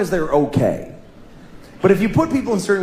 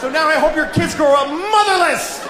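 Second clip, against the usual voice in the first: road rage shout; 9 semitones higher than usual. Retold or imitated. Road rage rant continued.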